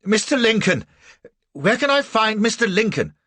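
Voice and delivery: high, loud desperate call; crying out. A narrator's reading. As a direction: call out for help, breathless. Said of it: "find the breath to cry out".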